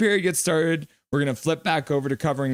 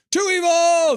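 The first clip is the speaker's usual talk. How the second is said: very high, louder squeal; mock cry; 14 semitones higher, 6 dB louder than usual. Streamer voicing a donor's comment in a very high, louder squeal. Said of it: "says Big Mac".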